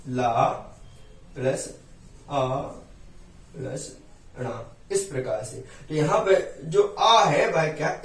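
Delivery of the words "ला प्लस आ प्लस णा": "ला... प्लस... आ... प्लस... णा" with a long pause after each part; split into parts. Shown as a demonstration. Teacher breaking the word up for students, "ला, प्लस, आ, प्लस, णा" spaced apart. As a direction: spell out "ला प्लस आ प्लस णा" piece by piece. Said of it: "लण का जब हम इससे विच्छेद करते हैं".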